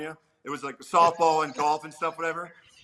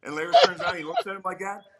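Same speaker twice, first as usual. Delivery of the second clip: normal talking voice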